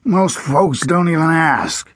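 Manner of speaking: put-on high, slow growl of an old sheriff. A narrator's reading. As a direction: growl it out like a grumpy lawman. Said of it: "he growled".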